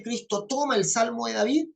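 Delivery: ordinary talk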